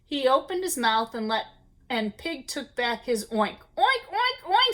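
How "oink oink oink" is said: high, rising oinks; happy piggy oinks; pig oinking. A narrator's reading. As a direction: pig with his oink back. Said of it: "said the pig".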